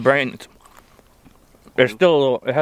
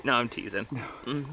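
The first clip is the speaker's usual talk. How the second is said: ordinary delivery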